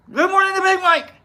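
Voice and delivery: high, loud put-on announcer voice for Big Mike; hammed-up greeting voice. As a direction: belt it out in a silly high voice.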